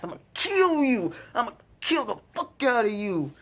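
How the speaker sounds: high, intense menacing growl of a threat; Rick's threat. Retold or imitated. Vlogger quoting Rick threatening Negan, menacing. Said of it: "Rick's just like".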